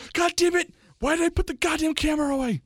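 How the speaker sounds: high-pitched mock outraged cry; imitating girlfriend. Girlfriend who missed filming the fall, mock exasperated shout. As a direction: yell it up high in comic frustration. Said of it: "my girlfriend's like".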